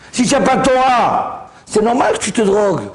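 high, forceful preaching outburst; impassioned rebuke from the pulpit; emphatic exhortation. Real, not acted. Preacher's emphatic climax to audience.

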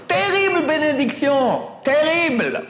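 high, slow cry of terrible; dramatic emphasis. Real, not acted. Rabbi to his students, a high dramatic cry. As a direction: lament the curse in a high voice.

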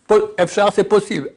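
ordinary talk

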